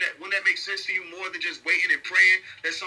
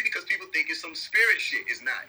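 normal talking voice

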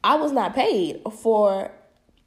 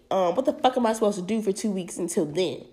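normal talking voice

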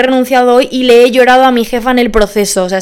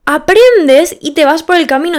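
higher and louder, cheering on; bright, louder pep talk; upbeat encouragement; 5 semitones higher, 4 dB louder than usual. Real, not acted. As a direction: lift the voice to encourage the listener. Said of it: "Y va a ser la decisión correcta".

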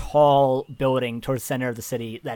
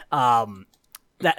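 ordinary delivery

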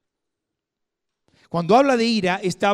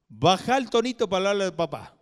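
stern father telling off his son, a touch louder; scolding father; 5 semitones higher, 6 dB louder, faster than usual. Retold or imitated. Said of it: "como le dijo a mi hijo Leandro varias veces".

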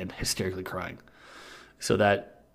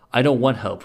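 mild mock whine, a bit louder; whining; 3 semitones higher, 7 dB louder than usual. A narrator's reading. Baby Richie refusing John's help, lightly acted whine. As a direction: protest like a whiny child, lightly. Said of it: "Richie whined".

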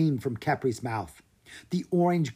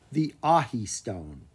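plain reading voice

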